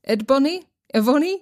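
usual speaking voice

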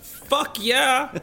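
loud, high "Fuck yeah"; excited yell. Real, not acted. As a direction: burst out with eager yes. Eager exclamation.

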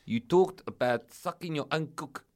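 hammed-up South African agent spelling out the complaint; South African accent. Agent complaining to Andy, put-on South African accent. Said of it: "just speak normally".

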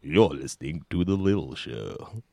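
low, slow lightly voiced Clooney; a hint of a smooth film star, slowed down; Clooney mock-voicing a show plug; Clooney impression. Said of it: "get George Clooney to say".

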